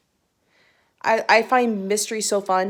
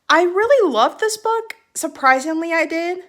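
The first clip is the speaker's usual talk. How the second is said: excited; 7 semitones higher, 3 dB louder than usual. Real, not acted. Gushing about a loved book.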